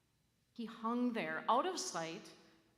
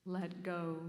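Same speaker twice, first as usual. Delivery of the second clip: calm voice of God; 4 semitones lower than usual. A narrator's reading. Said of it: "The voice said".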